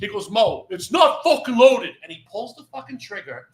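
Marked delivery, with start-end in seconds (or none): 0.3-1.9 loud, slow, imitated dad dismissing a worry; exasperated insistence. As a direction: push each word out, exasperated. Retelling dad insisting before firing.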